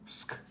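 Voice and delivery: "sk" as one blended sound, not letter names. Shown as a demonstration. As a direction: sound out "sk" as in the start of skate. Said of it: "our sounds are gonna be".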